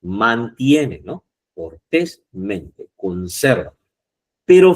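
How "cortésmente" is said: "Cortésmente" slowed syllable by syllable; slow teaching demo of "Cortésmente"; drawn out slowly. Shown as a demonstration. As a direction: spell out "Cortésmente" slowly, stressing TÉS.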